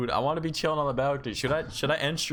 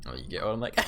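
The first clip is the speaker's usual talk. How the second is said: ordinary delivery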